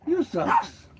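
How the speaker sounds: very high playful squeak; squeaky tease. Acted. Owner teasing the dog in a squeaky voice. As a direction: squeak it like baby talk.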